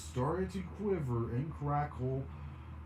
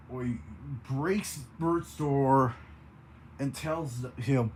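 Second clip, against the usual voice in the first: ordinary delivery, nothing marked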